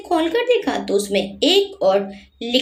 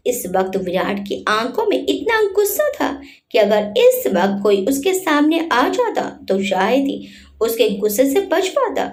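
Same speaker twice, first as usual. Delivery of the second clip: ordinary delivery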